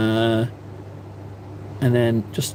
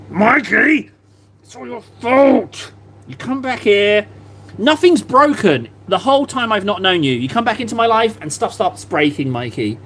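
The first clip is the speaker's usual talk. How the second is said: mock blame; 6 semitones higher than usual. Blaming friend for broken model.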